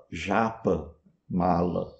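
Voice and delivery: "Japamala" as ZHAH-pa, MAH-la; soft Portuguese J opening "Japamala", said in two parts; Brazilian mispronunciation. Retold or imitated. Teacher quotes a common Brazilian mistake, zh sound in "Japamala". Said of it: "quando não fala".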